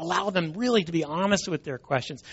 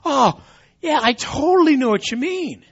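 mock agreement; 9 semitones higher, 4 dB louder than usual. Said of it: "I'm like".

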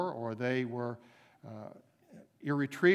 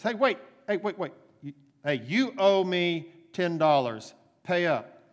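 angry demand; 4 semitones higher, 8 dB louder than usual. Acted. Servant accosts his debtor.

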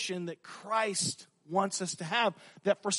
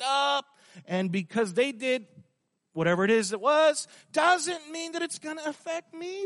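much higher, brash mocking young guy; a cocky churchgoer brushing off the pastor, high and mocking; cocky dude voice; 8 semitones higher than usual. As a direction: sneer it like an arrogant bro. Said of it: "What does that look like in today's culture".

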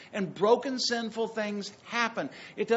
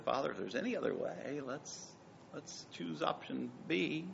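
quiet plea; 5 semitones lower, 10 dB quieter than usual. Retold or imitated. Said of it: "he said".

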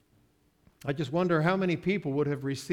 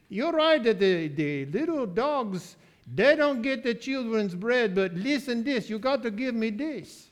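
higher, lilting Caribbean voice, pleading her case; Caribbean accent; 6 semitones higher, 3 dB louder than usual. Retold or imitated. Caribbean lady bargaining with Jesus for crumbs, Caribbean accent. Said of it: "But my Caribbean lady says".

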